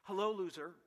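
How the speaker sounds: put-on a sneering teenage put-down; mock teen sneer. Preacher sneers as the woman at the well. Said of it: "Let me translate this for teenage language".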